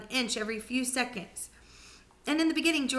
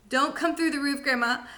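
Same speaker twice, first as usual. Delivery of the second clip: pleading prayer; 5 semitones higher, 3 dB louder than usual. Acted. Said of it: "he prayed".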